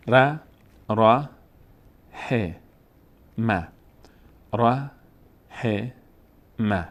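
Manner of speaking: "ر، ه، م" as separate syllables ra, he, ma; a clear pause after each voweled letter. Shown as a demonstration. Teacher showing beginners to read one by one, syllables apart.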